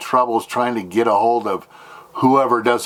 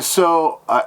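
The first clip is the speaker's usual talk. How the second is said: ordinary delivery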